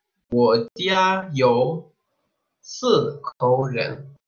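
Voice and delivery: slow, careful Mandarin answer; model Chinese answer. Shown as a demonstration. Teacher modelling the answer slowly for the class. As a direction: sound out each syllable for learners. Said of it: "vamos a responder".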